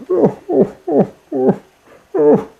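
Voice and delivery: high, broken crying oh's; high sobbing cries of despair; despairing wails. Acted. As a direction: wail in despair like a lost child. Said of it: "And in his despair".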